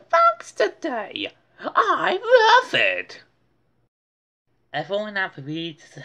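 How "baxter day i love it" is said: high, loud, slow joyful cry; delighted. Acted. Buster's mother loving the new holiday, delighted. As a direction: burst out with joy. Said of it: "Buster's mother smiled".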